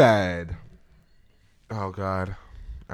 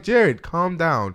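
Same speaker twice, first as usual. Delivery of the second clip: higher, louder, drawn-out "calm down"; retold exasperated plea, slow and raised; 7 semitones higher, 5 dB louder, much slower than usual. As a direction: slowly stretch it out, pitched up, telling someone to settle. Retelling own plea to roommate.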